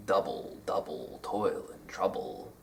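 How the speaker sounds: hushed, low "Double, double, toil and trouble", first syllable of each pair stressed; trochaic rhythm. Shown as a demonstration. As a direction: chant "Double, double, toil and trouble" softly, strong-weak. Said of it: "or trochaic rhythm".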